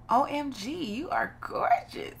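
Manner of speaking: high-pitched, gushing game host; over-the-top girly admiration; gushing compliment. Acted. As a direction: draw out the praise in a high gush.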